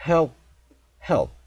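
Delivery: "Help" as one short syllable with faint P; English one-syllable help. Shown as a demonstration. Teacher drills learners, short "Help" with swallowed ending. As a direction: repeat "Help" with no vowel after P. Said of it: "좀 부족해 보이지만 해보세요".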